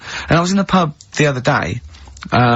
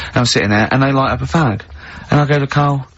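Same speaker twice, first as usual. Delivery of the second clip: normal talking voice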